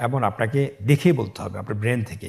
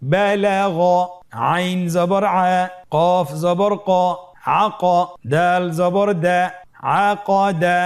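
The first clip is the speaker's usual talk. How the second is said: raised, loud teacher's spelling chant; higher, louder syllable-by-syllable spelling drill; 7 semitones higher, 5 dB louder, slower than usual. Shown as a demonstration. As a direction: call out each letter and vowel mark for the class. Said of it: "পরবর্তী লাইনের শব্দগুলো এভাবে বানান করে পড়তে হবে".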